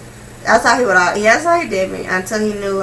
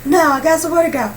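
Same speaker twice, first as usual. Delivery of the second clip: mock protest; 8 semitones higher than usual. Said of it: "I'm like".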